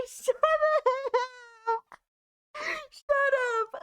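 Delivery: high, half-laughing, half-crying retort; tearful protest. Real, not acted. Streamer answering teasing about being a crier, tearful protest. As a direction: whine it back through tears.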